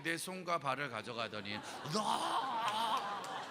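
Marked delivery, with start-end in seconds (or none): 2.0-3.4 loud high mock scream. Boy seized by praying kids at camp, loud mock scream. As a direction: scream as if grabbed by zombies. Zombies grabbing him, scream.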